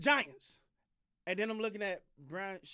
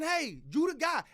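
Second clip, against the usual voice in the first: mock sales pitch, louder and higher; team courting a coach; 6 semitones higher, 6 dB louder than usual. Team executive telling a coaching candidate he's the one, in a mock pitch. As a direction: hype him up, slow and loud, like you're recruiting him.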